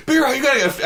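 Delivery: calling friend. Retold or imitated. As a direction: call him over excitedly. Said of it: "they're like".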